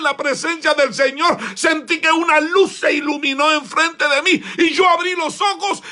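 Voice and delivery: high, loud impassioned preaching; preaching shout. Acted. Preacher reliving his altar moment, shouting. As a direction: shout it out at full force.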